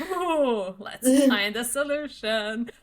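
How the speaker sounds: slow, eager with a laugh; hammed-up delighted fixer; gleeful problem solver. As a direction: relish the problem, coo it. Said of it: "I'm like".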